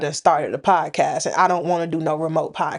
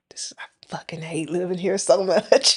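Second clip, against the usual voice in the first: low, whispery retold grumble; defeated mutter; 3 semitones lower, 4 dB quieter than usual. Retelling her fall while shoveling, whispery grumble. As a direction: grumble it almost without voice after a sigh. Retelling after slipping and falling.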